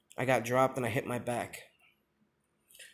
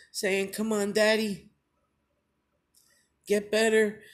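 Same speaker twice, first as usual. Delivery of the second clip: holding back sobs as he recalls his son; tearful, voice cracking; 6 semitones higher than usual. Real, not acted. Recalling his son at the hospital bed, tearful and high. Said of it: "I get real emotional".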